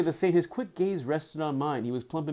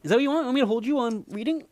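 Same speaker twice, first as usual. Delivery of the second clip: much higher cooing to the dog; fast, high doggy talk; 9 semitones higher, much faster than usual. Real, not acted. Cooing to her pug.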